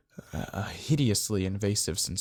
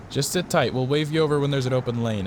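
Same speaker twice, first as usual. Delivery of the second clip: raised, higher, louder instruction; calling out; 5 semitones higher, 5 dB louder than usual. Acted. Officer telling driver to wait, calling out. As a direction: project over a storm.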